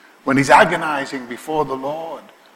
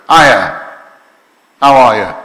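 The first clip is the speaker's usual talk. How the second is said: bright, louder, chummy hello; casual greeting; 9 dB louder than usual. Shown as a demonstration. Pastor recasting the angel's greeting, casual and louder. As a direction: greet her like an old friend, louder. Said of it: "let's put it another way".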